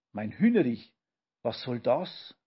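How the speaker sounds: ordinary delivery, nothing marked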